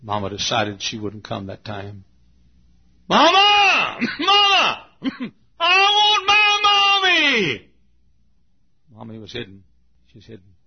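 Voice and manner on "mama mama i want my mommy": put-on loud, high, drawn-out child's wail; child crying out. Little boy wailing for his hidden mother, loud and high. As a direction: howl for mommy like a lost boy.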